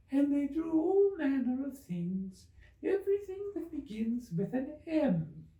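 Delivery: high, drowsy little Dormouse; sleepy Dormouse. Acted. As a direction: drift toward sleep in a high small voice.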